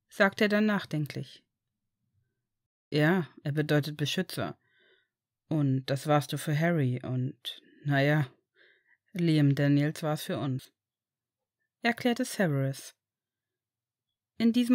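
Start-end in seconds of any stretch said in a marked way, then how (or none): 2.9-10.7 gentle father. Acted. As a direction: promise him he is safe now. Father explains the name. (tiefer, ruhiger, beruhigender Vater)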